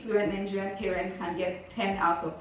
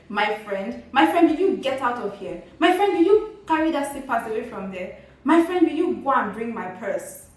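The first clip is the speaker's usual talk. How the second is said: louder, higher, nagging orders; irritated Nigerian parent; 4 semitones higher, 5 dB louder than usual. Retold or imitated. A fed-up parent bossing the child around, loud and irritated. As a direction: fire off chores at the child with a fed-up parent edge. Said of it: "It's like".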